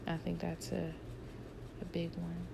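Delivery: soft trailing whisper. Real, not acted. Closing the point to her viewers, whispering. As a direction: let it fade to a whisper.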